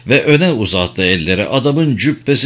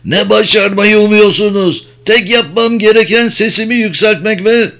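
high, loud, enraged outburst; angry shouting; 9 semitones higher, 4 dB louder than usual. A narrator's reading. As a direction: shout it in a raised, furious voice. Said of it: "diye bağırdı Balkis, sesi hırstan boğuklaşmış bir şekilde". (hırsla, tiz ve yüksek sesle bağırarak)

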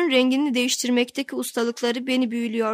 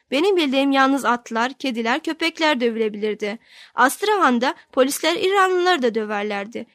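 ordinary delivery

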